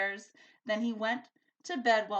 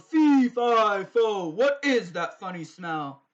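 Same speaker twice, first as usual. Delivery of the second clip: bellowing giant; 12 dB louder than usual. A narrator's reading. The giant bellows as he smells Sophie coming. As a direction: bellow out the rhyme. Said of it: "The giant bellowed".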